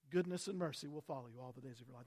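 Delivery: quick, quiet mock hedge; doubtful hedge. Pastor mocking a doubtful David, quiet and quick. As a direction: toss it off softly, as if unsure. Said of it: "David didn't say, "Maybe".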